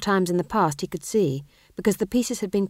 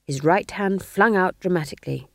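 ordinary delivery, nothing marked

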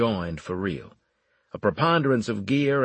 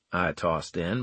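ordinary delivery

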